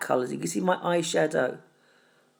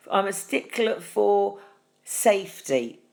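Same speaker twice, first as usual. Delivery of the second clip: usual speaking voice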